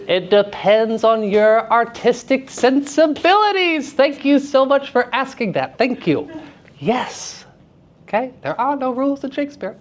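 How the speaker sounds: high, theatrical rejoicing; gleeful delight. Real, not acted. Teacher thrilled by student's question, gleeful and high. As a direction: celebrate it with hammed-up glee, voice soaring.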